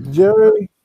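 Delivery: a high, loud call of the name. Retold or imitated. Calling out to Jerry at the bar, loud and high. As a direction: shout his name across the room.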